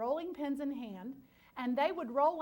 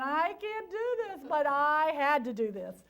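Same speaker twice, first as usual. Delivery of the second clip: retold crying; 3 semitones higher, 6 dB louder than usual. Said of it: "sitting on the kitchen floor crying".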